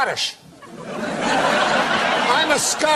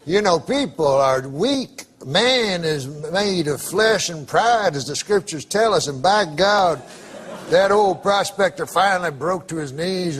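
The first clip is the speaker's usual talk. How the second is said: solemn storytelling; 6 semitones lower than usual. A narrator's reading.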